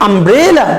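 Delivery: umbrella with the middle syllable stretched and rising; stress on BRE. Shown as a demonstration. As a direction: lean on "bre" in umbrella, then fall away. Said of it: "এখানে আমরা একটু জোর দিয়ে বলবো".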